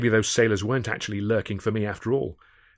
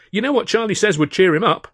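much higher and louder call; 11 semitones higher, 9 dB louder than usual. A narrator's reading. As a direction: call across the room, bright. Calling across to Alf.

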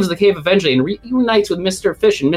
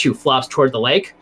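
ordinary talk, nothing marked

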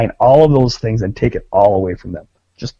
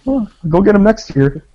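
much higher, fake-chipper congratulations; mock oblivious; 9 semitones higher than usual. Joking with friends about France, mock chipper and oblivious. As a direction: gush like you never heard of soccer. Playing oblivious American fan.

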